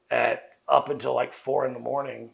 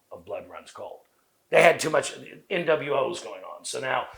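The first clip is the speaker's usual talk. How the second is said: ordinary delivery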